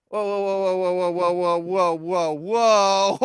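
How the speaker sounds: rapid-fire run of whoas, swelling at the end; alarmed interruption. Acted. Halting a partner who mentioned burying a body, alarmed. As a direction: cut in to halt everything.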